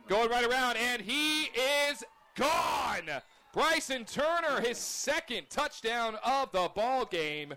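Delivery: shouting, drawn-out touchdown call. Real, not acted. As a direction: belt out the touchdown, slow and high. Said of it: "touchdown run, announcer shouts".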